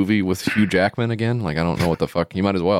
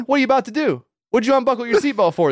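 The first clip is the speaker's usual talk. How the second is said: much higher, squeaky accusing question; mock suspicious attendant; 13 semitones higher, faster than usual. Attendant to the driver who leaned over, high mock suspicion. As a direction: caricature the attendant as shrill and paranoid. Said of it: "the parking attendant's like".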